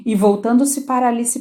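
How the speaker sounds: ordinary delivery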